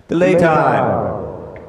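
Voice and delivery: loud echoing delay; slow, loud, echoed; delay effect. Shown as a demonstration.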